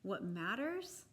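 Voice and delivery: ordinary delivery, nothing marked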